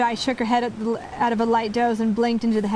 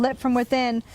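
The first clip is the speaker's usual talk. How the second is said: plain reading voice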